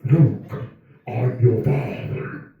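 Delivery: slow, heavy-breathing Vader voice, lightly done; a hint of Vader wheezing in the dark; Darth Vader. Chubby comedian mimicking his own snoring as Vader, slow. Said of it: "Breathing like Darth Vader".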